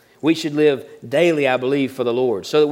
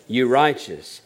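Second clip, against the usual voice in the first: "You righteous" landed with a fall; stress on righteous; 3 semitones lower, slower than usual. Shown as a demonstration. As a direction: drop firmly onto "righteous". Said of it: "But what does he say?".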